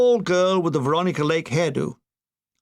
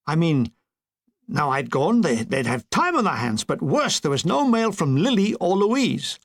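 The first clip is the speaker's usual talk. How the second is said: ordinary delivery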